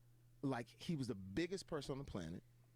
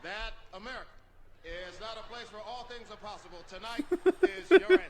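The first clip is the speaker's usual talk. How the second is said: raised, measured presidential oratory; Obama; 5 semitones higher, 12 dB louder than usual. Acted. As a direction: pause between phrases and build to a loud finish. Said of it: "Obama loves it".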